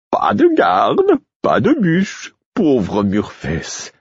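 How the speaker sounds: high mock lament, drawn-out ending; singsong jester's pity; crestfallen fool. Fool reporting no guards to Murfess, mock-crestfallen. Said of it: "la mine déconfite".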